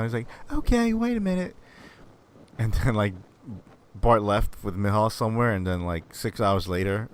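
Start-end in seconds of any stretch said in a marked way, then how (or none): 0.5-1.6 put-on kid agreeing. Kid voiced in retold prank.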